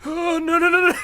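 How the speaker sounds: loud, high panicked no-no-no; gasp, then yelp in alarm; alarmed cry. Real, not acted. Sudden mishap in the game.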